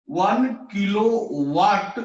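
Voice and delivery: वन kilowatt said very slowly, each syllable drawn out; slow reading demo. Shown as a demonstration. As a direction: spell out वन kilowatt slowly for the class. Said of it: "अगर हम बोले". (धीरे-धीरे पढ़कर दिखाना)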